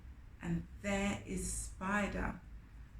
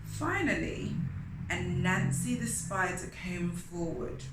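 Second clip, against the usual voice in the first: usual speaking voice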